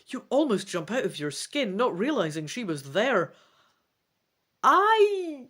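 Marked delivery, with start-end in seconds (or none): 4.6-5.5 high, halting confession; shamefaced. A narrator's reading. Trion confesses to Rhinath, ashamed and higher. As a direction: admit it with embarrassment. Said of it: "you explain, shamefaced".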